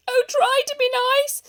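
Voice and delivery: high, loud frightened plea; whimpering. Acted. Lion backing away from the mouse, frightened whimper. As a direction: beg like a terrified big cat. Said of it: "he whimpered".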